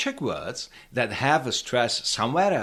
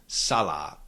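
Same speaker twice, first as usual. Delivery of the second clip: "Salad" with SA stressed, lower falling long second a; stress on first syllable; 6 semitones lower than usual. Shown as a demonstration. Teacher correcting the mistake, first-syllable stress on "Salad". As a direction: hit SA in "Salad", let the long A fall. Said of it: "keep the stress on the first syllable".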